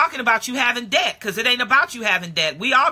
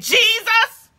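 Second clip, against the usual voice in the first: yelling out; 6 semitones higher, slower than usual. Acted. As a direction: cry out to Jesus like a desperate blind beggar. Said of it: "yelling out in Luke chapter eighteen".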